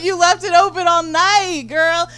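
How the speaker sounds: mock-outraged telling-off; scolding. Scolding her girlfriend about the open door, loud and high. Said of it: "I'm like".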